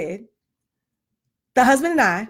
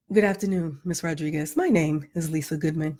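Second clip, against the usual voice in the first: a lightly polished, proper phone voice, soft and low; Claire Huxtable voice; 8 semitones lower, 5 dB quieter than usual. Retold or imitated. Mom calling the school office, in a lightly polished proper voice. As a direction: sound educated and well-spoken, softly. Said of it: "in my Claire Huxtable voice".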